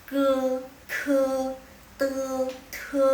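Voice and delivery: loud, clear practice syllables; drill syllables. Shown as a demonstration. Teacher drilling aspirated syllables for viewers, loud. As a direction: bark out each syllable for the class.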